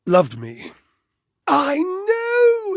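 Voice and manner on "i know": high, breaking cry of despair. Acted. To the listener, about Washford, a high breaking wail. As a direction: let the voice crack into a wail. Emotional outburst over lost love.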